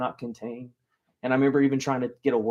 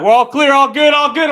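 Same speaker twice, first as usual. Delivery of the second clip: much higher and louder breezy all-clear; operator calling out; 11 semitones higher, 14 dB louder than usual. Retold or imitated. Ride operator calling all clear to the riders, loud and breezy. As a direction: call it out carelessly across the ride.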